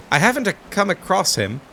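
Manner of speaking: light mock Italian accent on "I haven't a come across him". Player voicing a villager with a sudden Italian accent. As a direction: lilt it like a hammed-up Italian. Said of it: "I don't know why I went Italian".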